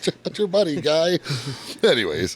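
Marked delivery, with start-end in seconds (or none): 0.1-1.2 high mock Canadian retort. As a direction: pitch it way up like a jokey Canadian. Repeating Canadian meme higher.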